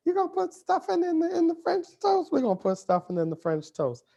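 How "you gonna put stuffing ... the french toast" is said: very high, incredulous put-on voice of a doubting friend; squeaky mock disbelief. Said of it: "She's like".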